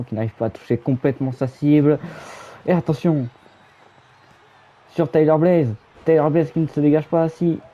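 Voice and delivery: rising excitement on the near-fall; excited commentator. Acted.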